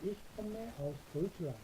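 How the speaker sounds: ich komme aus Deutschland said extremely slowly, word by word; dictation pace. Shown as a demonstration. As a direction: spell it out slowly for beginners. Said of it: "자".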